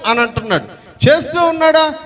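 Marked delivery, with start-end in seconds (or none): 1.1-1.9 indignant surprise. Acted. People protest God's accusation.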